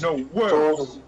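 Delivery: a playful Al Capone-style tough guy; mock Chicago gangster. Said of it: "Al Capone fucking thing".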